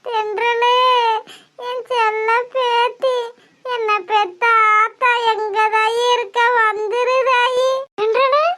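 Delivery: slow singsong keening; drawn-out crying chant; wailing lament. Acted. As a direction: mourn a grandchild in long, tearful phrases. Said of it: "ஒப்பாரி வச்சுக்கிட்டு இருக்க".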